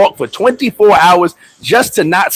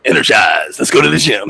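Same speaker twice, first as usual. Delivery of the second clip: deep hype voice; 9 semitones lower than usual. Retold or imitated. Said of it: "And I go".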